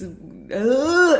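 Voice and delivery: high-pitched groan of being overwhelmed; weird noise. Real, not acted. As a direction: let feeling spill out as a high "ugh". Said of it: "I'm sorry for my weird noises".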